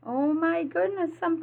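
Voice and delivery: real little gasp of wonder; delighted surprise. Real, not acted. Reader reacts to picture.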